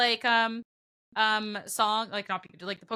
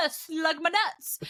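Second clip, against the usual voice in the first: slow, drawn-out punchline; gleeful gotcha; 4 semitones higher, much slower than usual. Acted. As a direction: stretch it out like landing a prank. Acting out the prank's punchline.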